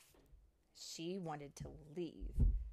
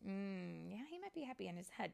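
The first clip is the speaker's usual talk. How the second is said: usual speaking voice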